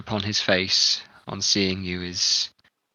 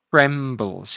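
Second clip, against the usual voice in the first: ordinary delivery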